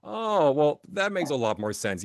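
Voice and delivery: mock realization. Newcomer finally understands what rushing means, acted realization. Sudden understanding in retold exchange.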